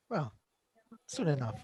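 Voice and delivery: usual speaking voice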